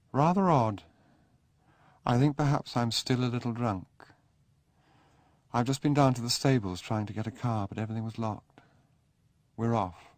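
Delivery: a lightly voiced young aristocrat, still a little drunk; hungover Sebastian. Sebastian telling Charles he is leaving, restless and high.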